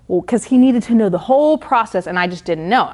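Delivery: ordinary talk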